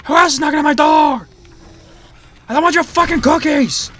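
loud, high exasperated yelling; fed-up yelling at intruding characters; annoyed shout. Real, not acted. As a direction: push them away, fed up. Annoyed at game visitors.

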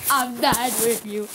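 ordinary delivery

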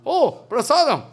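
light up over the offered food; eager delight. Retold or imitated. Mahaprabhu eagerly greets offered food.

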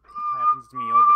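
sudden very high squeak; squeaky voice. Pretend. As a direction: squeak it out in mock self-pity. Squeaky mock lament.

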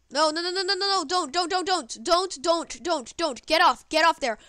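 rapid high no no no don't, rising alarm; begging the game not to happen; panicked pleading. Real, not acted. Gamer pleading as he is about to lose, panicked high begging.

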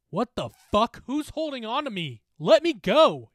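high, loud, building to a yell; alarmed shout. Acted. Bully suspended in midair by an unseen thing, shouting. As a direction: yell to be released. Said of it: "he shouted".

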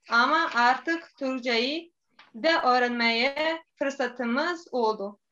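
Bosnian accent in Turkish; careful learner's Turkish with a Bosnian accent; foreigner broken turkish. Real, not acted. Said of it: "Üç yıldır Türkçe öğreniyorum".

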